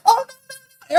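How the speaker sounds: mock protest. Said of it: "then it's like".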